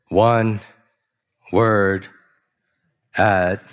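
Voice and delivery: word by word, long pauses between; talking slowly. Shown as a demonstration. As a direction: drag it out, one isolated word at a time. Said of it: "when I talk slowly".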